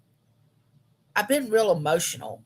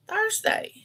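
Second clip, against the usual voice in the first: "Thursday" shot up much higher with a rising tune; disbelieving Thursday. Real, not acted. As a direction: exclaim "Thursday" as if you can't believe it. Repeats the day in disbelief.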